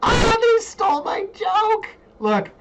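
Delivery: very high, drawn-out mock wail; mock outrage. Host protesting a stolen joke to viewers, mock-outraged wail. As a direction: wail it slowly and loudly, hammed-up.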